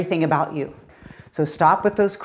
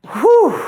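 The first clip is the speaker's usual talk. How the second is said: breathy whew; 11 semitones higher, 5 dB louder than usual. Real, not acted. Tired instructor mid-workout.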